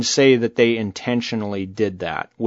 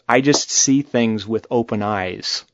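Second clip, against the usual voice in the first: ordinary talk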